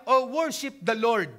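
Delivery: slow, solemn psalm recital in a changed voice; scripture reading. Acted. Preacher reading David's psalm to the congregation, slow and solemn. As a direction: proclaim the verse like King David. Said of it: "Sabi niya".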